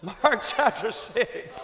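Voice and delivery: slow, chuckling repeat; laughing. Acted. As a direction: laugh your way through it.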